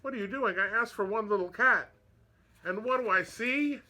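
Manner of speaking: put-on high cry of the old woman. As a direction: call out in dismay. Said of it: "she cried".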